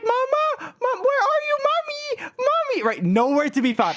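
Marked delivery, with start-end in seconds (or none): 0.0-2.8 put-on high, childlike calling for mom; calling for mommy. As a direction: call out like a lost little kid. Child calling lost mother.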